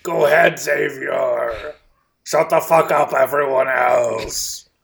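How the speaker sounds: loud slow angry yell; angry shout. Pretend. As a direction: yell it at everyone. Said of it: "Angry [name] has no time for you".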